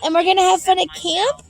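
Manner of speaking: mother cooing excitedly to her son Miles; sweet talk to her son. Real, not acted.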